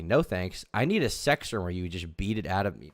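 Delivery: usual speaking voice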